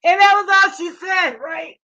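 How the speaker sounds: high, loud burst of laughter through the words; laughing hard. Real, not acted. Telling friends what she said, laughing hard. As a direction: crack up while telling it. Laughing while retelling a remark.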